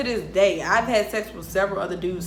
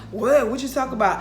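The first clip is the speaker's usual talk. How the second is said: normal talking voice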